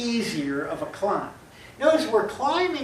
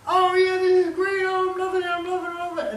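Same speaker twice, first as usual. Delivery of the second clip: much higher and louder, gleeful; thrilled roller coaster rider; 10 semitones higher, 5 dB louder, faster than usual. Pretend. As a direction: squeal with joy at the top of the ride. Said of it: "I'm at the top and I'm going".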